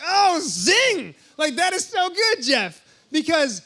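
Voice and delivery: loud, high delighted cry; excited zing. Retold or imitated. Reacting to Jeff's clever question, loud and high with delight. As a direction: celebrate a great comeback. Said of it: "I'm like".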